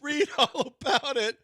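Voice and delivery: laughing mock newsie call; half-hearted paper boy cry; imitating newsboy. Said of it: "I don't know what he said".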